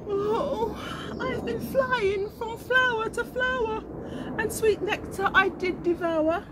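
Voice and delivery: high, weepy little bee voice; a worn-out bee whimpering her story; tired bumblebee. Acted.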